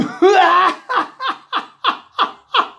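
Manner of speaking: high, loud burst of staged laughter; policeman's huge laugh. A narrator's reading. Policeman laughing at Jack's rope plan, uproarious. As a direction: erupt into a long, hammed-up guffaw. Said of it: "laughed the policeman".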